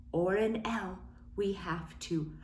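soft whispered aside; whispering. Shown as a demonstration. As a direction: whisper it like a secret. Sudden whisper to children.

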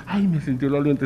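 soft, whimpering mock sob; hammed-up tearful lament; mock crying. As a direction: whimper as if your secret is out. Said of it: "empieza a llorar y dice".

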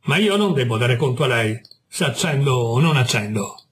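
usual speaking voice

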